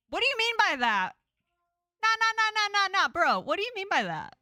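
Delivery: high, loud outraged yell; shouting. Acted. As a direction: yell it in disbelief. Player yells at friend's confession.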